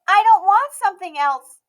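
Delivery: put-on high, loud little girl insisting; a child refusing any other gift; insistent Emmy. A narrator's reading. Emmy upset.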